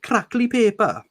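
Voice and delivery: relishing a Welsh lilt; Welsh accent. Acted. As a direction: roll the Welsh words lovingly. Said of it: "Good Welsh word".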